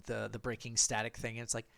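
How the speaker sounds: ordinary delivery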